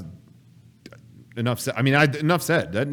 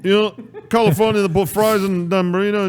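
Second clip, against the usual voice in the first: high, loud Scottish caricature; mock Scottish accent; 7 semitones higher, 5 dB louder than usual. Explaining California burritos in the riff, mock Scottish accent. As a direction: ham up a thick Scottish accent. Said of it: "what a Scottish accent would sound like".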